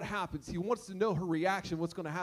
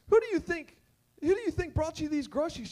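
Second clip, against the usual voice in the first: angry neighbor; 7 semitones higher than usual. Retold or imitated. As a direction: demand it like a frustrated atheist. Angry atheist neighbor confronts her.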